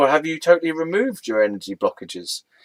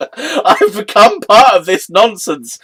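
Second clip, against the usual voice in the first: higher, louder, slow, laughing through words; giggling at own silliness; amused exasperation with laughter; 9 semitones higher, 10 dB louder, slower than usual. Real, not acted.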